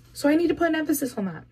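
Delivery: ordinary talk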